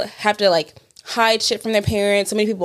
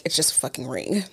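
deadpan retort; 7 semitones lower, 8 dB quieter than usual. Retold or imitated. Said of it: "And I'm like".

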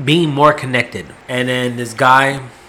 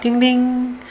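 register chime; 11 semitones higher than usual. Retold or imitated.

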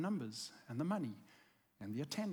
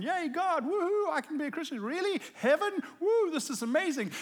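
higher, bouncy mock cheer; giddy kid excitement; 9 semitones higher, 6 dB louder than usual. Pastor imitating his kid self cheering to God, higher and giddy. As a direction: imitate a kid thrilled about heaven.

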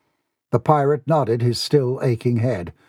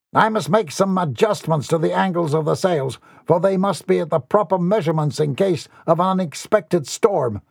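higher-pitched, busy captain voice; self-important young captain; 6 semitones higher than usual. Acted. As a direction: announce it like a captain in charge. Boy playing a ship's captain.

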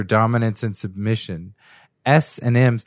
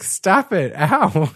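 mock protest; 7 semitones higher, much slower than usual. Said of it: "And he's like".

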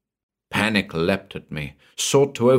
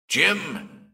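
high calling shout; desperate shout across empty space; shouted name; 10 semitones higher than usual. Acted. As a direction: call out for Jim into the void. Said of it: "I shouted".

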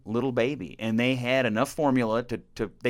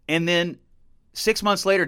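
ordinary delivery

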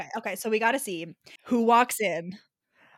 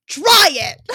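higher and much louder shout; shouted threat; 5 semitones higher, 11 dB louder than usual. Acted. Hero reading his threat aloud, shouting. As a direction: bark the dare. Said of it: "I said".

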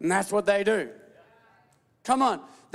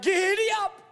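eager glee; 8 semitones higher than usual. Retold or imitated. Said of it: "They're like".

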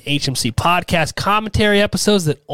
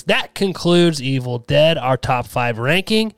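usual speaking voice

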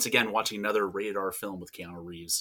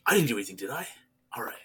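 clueless Keanu; 5 semitones higher, 3 dB louder than usual. Retold or imitated. Acting out clueless Keanu scene.

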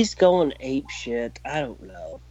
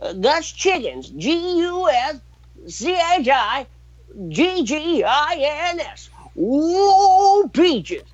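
high, slow spelled-out prospector voice; Old Prospector; 10 semitones higher, slower than usual. Retold or imitated. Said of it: "Old Prospect is the greatest skit".

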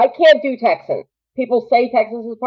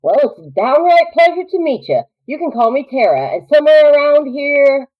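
hearty Southern frontierswoman, pitched up; Southern drawl; 5 semitones higher than usual. Acted. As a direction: introduce yourself in a North Carolina drawl. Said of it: "might channel a little bit North Carolina".

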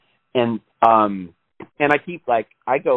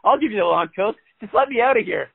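much higher, desperate mock bargaining; cracking captive impression; 11 semitones higher than usual. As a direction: play a prisoner begging to trade secrets for freedom. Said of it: "I can see you right now".

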